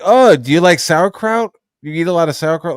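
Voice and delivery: loud, high-pitched mock nosy questioning; Harlan Ellison. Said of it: "like Harlan, would he have been like".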